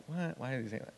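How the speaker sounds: hushed, puzzled aside; imitated puzzled listeners. Preacher voicing the congregation's doubt about his prayer, hushed and puzzled. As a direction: drop the volume, mimicking a doubtful congregation. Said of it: "you're all kinda like".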